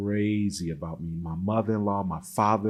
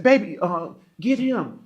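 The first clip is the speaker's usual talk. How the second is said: retold raised voice; 9 semitones higher than usual. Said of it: "You're shouting at me".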